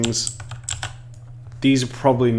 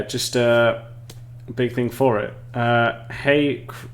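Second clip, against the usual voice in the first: ordinary talk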